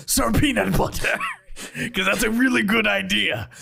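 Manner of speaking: high, exclaiming character voice; Benjo. Acted. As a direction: call out grandly, drawing out the final command. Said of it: "you hear Benjo go".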